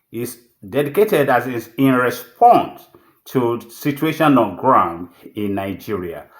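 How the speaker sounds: low, measured station opening, with long pauses; formal broadcast announcement. Real, not acted. Broadcaster opening an editorial monologue to his audience, formal announcer delivery.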